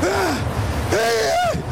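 high, loud frightened cries; panicked gasp. Acted. Peter cries out as he starts to sink.

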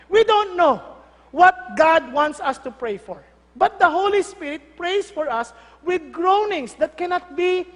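loud, slow, emphatic scripture reading; preaching. Real, not acted. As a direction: proclaim the verse phrase by phrase. Reads scripture with preaching force. (madiing pagbasa ng Bibliya)

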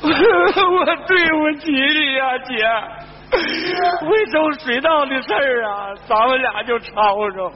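hammed-up weeping remorse, phrase by phrase; tearful confession. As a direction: cry out an apology to a dead sister. Weeping apology to sister's body.

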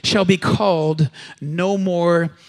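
slow, together with the crowd; congregation recitation. Acted. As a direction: chant it slowly as one voice. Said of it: "Say it with me".

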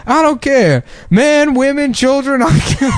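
high, frantic comedian voice; Steve Harvey impression. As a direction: yell it in his jittery voice. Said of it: "you know, he's like".

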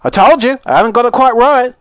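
high-pitched, exasperated lament; acted sigh. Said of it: "sighed Mister Wonka".

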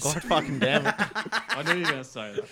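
loud protest through laughter; laughing exasperation. Real, not acted. Storyteller to friend who landed the Doritos gag, laughing exasperation. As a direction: groan at the predictable gag while laughing.

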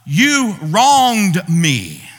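a loud, drawn-out accusation; accusing God. Pretend. Believer loudly accusing God of wronging him, slowly. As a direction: stretch out the charge against God, loud and slow. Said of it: "we're saying".

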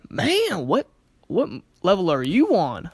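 playful, exaggerated disbelief to jolt someone; acted astonishment. Shown as a demonstration. Speaker showing how to interrupt an upset person, loud astonishment.

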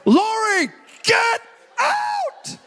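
very high squeaky yell at Lori; mock panicked shout. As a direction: shriek it like a scared man pushing her forward. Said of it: "And now I'm like".

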